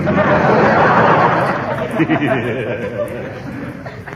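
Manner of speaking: embarrassed rolling chuckle; flattered giggle; bashful chuckle. Acted. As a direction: chuckle shyly, caught off guard by flattery. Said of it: "that's the first time you've laughed in a week".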